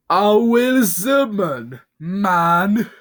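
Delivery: slow, very high, loud rant; unhinged scream. Acted. Bob rants like a lunatic, screaming slow and high. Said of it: "he screamed".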